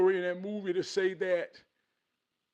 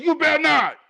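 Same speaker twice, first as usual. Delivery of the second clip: loud, high-pitched scolding wife; wife's warning; 9 semitones higher, 11 dB louder than usual. Retold or imitated. Wife warning husband about his fangs, loud scolding. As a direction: imitate a wife warning her husband loudly. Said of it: "My wife said".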